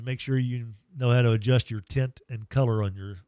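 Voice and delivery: usual speaking voice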